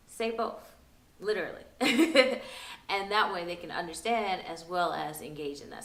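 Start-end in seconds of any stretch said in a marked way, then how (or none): 1.7-2.8 short high, loud giggle; chuckle. Real, not acted. Teacher amused after saying "say both, literally", loud giggle. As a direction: giggle out loud at your own remark. Amused at her own advice.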